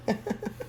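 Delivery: quiet, short little laugh; soft chuckle. Real, not acted. Cook waiting on the food, soft chuckle. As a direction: chuckle softly to yourself.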